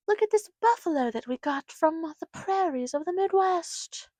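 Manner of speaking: mock tour guide. Mocking sign reader voice.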